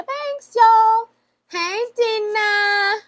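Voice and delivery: high, bright-eyed squeal of the name; sudden high excited greeting, drawn-out Hey. Real, not acted. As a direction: greet her like a long-lost friend. Excited reveal of sender's name.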